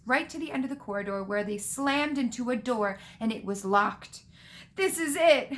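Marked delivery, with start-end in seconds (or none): 4.8-5.5 high, louder moan of despair. A narrator's reading. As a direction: moan it as if doomed. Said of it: "Ron moaned".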